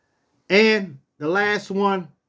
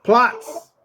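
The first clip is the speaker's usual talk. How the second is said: firm, raised order like a drill sergeant; higher, authoritative bark of command; 4 semitones higher than usual. Shown as a demonstration. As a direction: order the dog down. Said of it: "say it with a authoritative voice".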